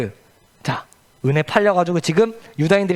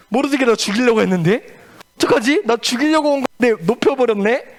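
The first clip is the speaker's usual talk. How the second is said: panicked Haman; 5 semitones higher than usual. Acted. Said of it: "깜짝 놀랐어요".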